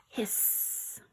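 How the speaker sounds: soft voiceless hiss; quiet breathy goose hiss. Shown as a demonstration. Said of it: "honk and hiss just like this".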